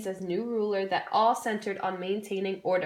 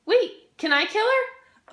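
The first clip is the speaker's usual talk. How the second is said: high, loud, eager plea; eager excitement; 12 semitones higher, 6 dB louder, slower than usual. Pretend. Classmate begging to play executioner, high eager plea. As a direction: beg for the chance, much higher and louder.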